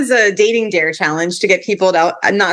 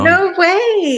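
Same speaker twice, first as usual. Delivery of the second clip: surprised; 12 semitones higher than usual. Real, not acted. Reacting to a surprising story.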